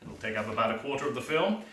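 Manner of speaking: usual speaking voice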